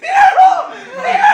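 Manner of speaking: very high, loud laughing yell; laughing shriek. Real, not acted. Friend caught, howling.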